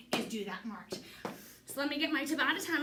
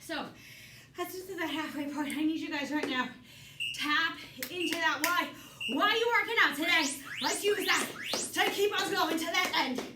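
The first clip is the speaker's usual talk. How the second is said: aerobics instructor; 4 semitones higher than usual. Acted. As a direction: fire up the class. Coach pushing class mid-round.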